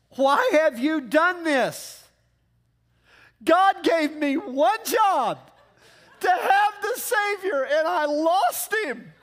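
loud, high, slow panicked mother yelling at her son; hammed-up frantic mom losing it; freaked-out mother. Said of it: "She was in freak mode".

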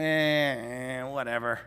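low, dismissive eh whatever; unbothered shrug. Retold or imitated. Neo shrugging off the bullets, a mild careless eh. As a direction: brush it off like nothing matters. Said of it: "it's just like".

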